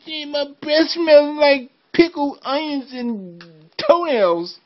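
high, slow goofy voice; silly high voice. Acted. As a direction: squeak it out like a goofy creature. Father doing silly voice.

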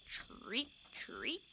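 high chirping chirreep; cricket chirp. A narrator's reading. Narrator chirping the cricket under the doorstep.

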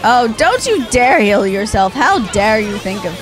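normal talking voice

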